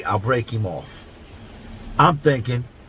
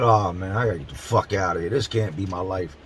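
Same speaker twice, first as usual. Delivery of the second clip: lower, softer, fast weary dread; despairing mutter; 6 semitones lower, 4 dB quieter than usual. Retold or imitated. Done with his cellmate's craziness, low despair. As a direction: say it to yourself, wanting out. Reacting to cellmate's request for more.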